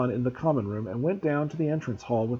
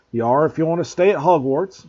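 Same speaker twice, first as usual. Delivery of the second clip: louder, stern Hagrid retort; fierce; 4 semitones higher, 7 dB louder than usual. A narrator's reading. Groundskeeper facing down a refusing pupil, fierce. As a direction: lay down the law fiercely. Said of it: "said Hagrid fiercely".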